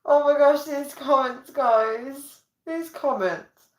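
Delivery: high-pitched, mock-appalled reaction; scandalised amusement. Real, not acted. Streamer reacting to cheeky viewer comments, scandalised. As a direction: exclaim high, drawing out the last words.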